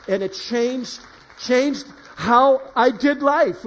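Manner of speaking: rising, loud and slow on changed how I did life; emphatic testimony. Real, not acted. Preacher to congregation, loud emphatic testimony. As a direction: stretch it out with conviction.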